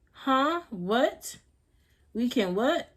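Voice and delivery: high, rising disbelief, re-enacted; taken aback. Storyteller replaying her shock at being invited to smoke, taken aback. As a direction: act out a baffled double-take, voice lifting. Reacting to surprise offer to smoke.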